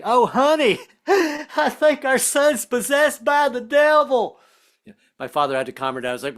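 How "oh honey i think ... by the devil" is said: high, slow, horrified Southern mom; alarmed mother. Retold or imitated. Mother frets to the father about her son, high and horrified. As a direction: gasp it out like a shocked Kentucky mother. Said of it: "She was like".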